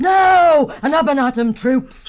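loud, high battle cry; commander's full-voiced attack shout. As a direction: shout the troops into a charge. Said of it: "The vixen ran out shouting".

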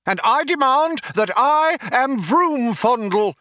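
high-pitched, strident declaration; demanding shout. A narrator's reading. Younger intruder introduces himself as a demand, indignant shout. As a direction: shout it like a protester. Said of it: "shouted the younger one".

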